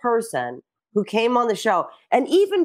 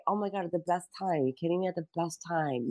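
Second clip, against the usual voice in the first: softer, lower, quick murmur; soft gracious reassurance; 4 semitones lower, 10 dB quieter, faster than usual. Retold or imitated. Losing contestants reassuring the host, soft and gracious. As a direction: wave it off softly and quickly.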